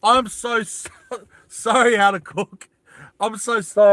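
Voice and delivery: giggling, loud apologetic sorry; embarrassed laughing apology. Real, not acted. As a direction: laugh through the sorries.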